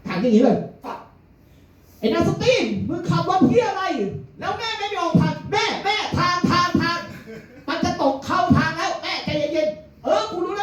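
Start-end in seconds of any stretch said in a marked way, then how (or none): 1.3-10.6 much higher, slow shouted insult; mother shouting. Retold or imitated. Mother shouts out the car window at a driver, much higher shouted abuse. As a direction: yell across at the driver up high. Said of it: "แม่ก็ตะโกน".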